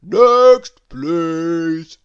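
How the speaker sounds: loud, drawn-out butcher's call; big booming summons. A narrator's reading. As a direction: boom for the next customer. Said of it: "boomed the butcher".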